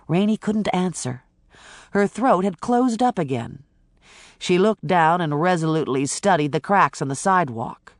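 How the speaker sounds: plain reading voice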